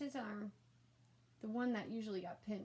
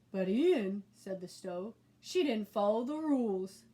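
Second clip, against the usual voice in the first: much louder, odd rumbling creature voice; stove monster; 9 dB louder than usual. A narrator's reading. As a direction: object like a reasonable monster.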